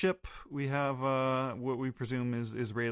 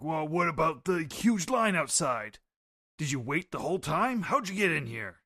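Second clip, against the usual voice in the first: voicing Rox; 5 semitones higher than usual. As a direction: read Rox as a brash pirate boss. Rox quoted while reading the comic.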